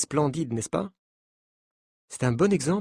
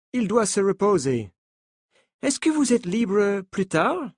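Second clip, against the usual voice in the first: put-on higher French with a light American accent; 5 semitones higher than usual. Coach telling the journalists not to panic, in a light American accent. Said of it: "dit-il avec un accent américain".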